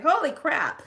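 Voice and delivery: high, loud startled exclamation; shocked. Retold or imitated. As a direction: blurt it out in shock. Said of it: "And I was like".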